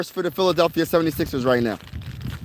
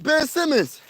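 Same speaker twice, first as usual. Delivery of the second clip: higher, amazed question; stunned fan asking the player; surprised; 7 semitones higher than usual. Retold or imitated. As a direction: ask it half-laughing in disbelief. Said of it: "I said".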